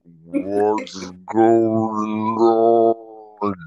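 slowed-down drawn-out voice; Slow-Mo voice guy. Retold or imitated. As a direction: stretch every word like slow motion. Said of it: "whenever I do Slow-Mo voice guy".

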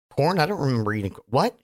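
ordinary delivery

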